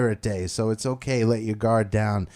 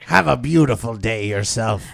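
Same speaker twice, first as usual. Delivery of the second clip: pirate voice; 6 dB louder than usual. Pretend. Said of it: "we love to talk like pirates".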